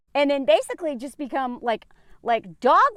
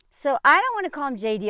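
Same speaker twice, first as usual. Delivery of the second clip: normal talking voice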